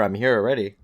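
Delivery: ordinary talk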